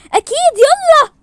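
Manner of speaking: high, loud, drawn-out call; rally them loudly, stretching the words; loud and high, urging; urgent agreement. Acted.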